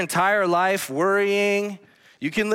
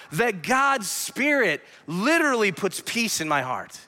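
ordinary delivery

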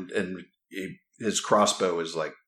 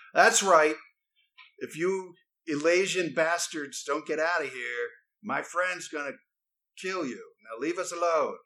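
higher-pitched threatening character voice; hostile crossbowman's companion; 8 semitones higher than usual. Acted. Game master as foe warning party off, hostile, higher-pitched. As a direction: warn them off in a raised pitch. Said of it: "he goes".

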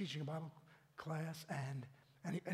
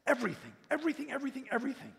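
building fervour; 6 semitones higher, 6 dB louder, faster than usual. Real, not acted. Climax of surrender in prayer.

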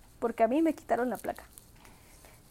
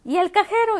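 louder, higher accusing question; scold-ask it; loud higher mom demanding an answer; mom's demand; 7 semitones higher, 6 dB louder than usual. Retold or imitated. Mom asking about the ATM.

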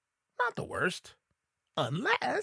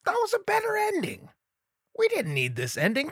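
mock indignant; 8 semitones higher than usual. Revived Dave to the doctors, huffy complaint. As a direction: sulk theatrically.

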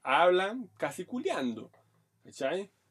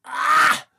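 loud high cry out; cry of climax; 10 semitones higher, 12 dB louder than usual. Retold or imitated. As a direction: let out a sharp high cry. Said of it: "Y ella me dijo". (grito agudo y fuerte)

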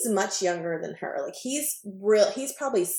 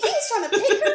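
high, laughing squeal of gossip; excited retelling; 4 dB louder than usual. Retold or imitated. Telling co-host about the pickup, giddy and laughing. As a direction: laugh through it, pitched way up.

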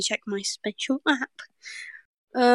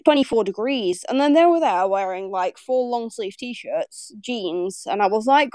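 ordinary delivery

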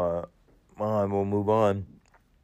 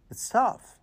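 voice cracking; 12 semitones higher than usual. Real, not acted. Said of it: "Sorry, I'm getting a little emotional".

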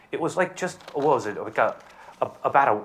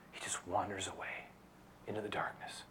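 a whisper, much softer; 4 semitones lower, 18 dB quieter than usual. A narrator's reading. Preacher describing Judas leaving, whispered. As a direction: breathe it out as a hushed whisper.

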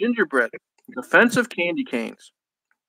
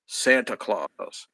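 plain reading voice

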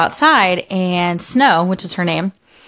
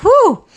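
high, loud whoop of relief; exaggerated retold sigh of relief; relieved whew; 7 dB louder than usual. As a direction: let out a big high "whew". Retold relief at keeping the job.

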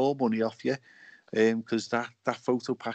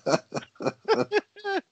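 dolphin laugh. Retold or imitated. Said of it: "That's my dolphin impression".

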